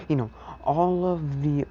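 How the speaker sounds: plain reading voice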